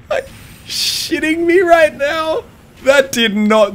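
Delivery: high, slow laughing disbelief; shocked laughter. Real, not acted. Unbelievable game moment, laughing.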